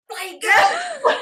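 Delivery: high, slow, mock-angry bloody girl; exasperated grumble. Director leaving the room, slow mock-angry outburst. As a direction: storm off muttering it, half laughing. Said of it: "walked out the door going".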